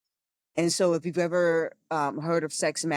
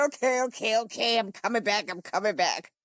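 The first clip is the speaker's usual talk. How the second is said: giving in; 3 semitones higher than usual. Retold or imitated. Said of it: "I said".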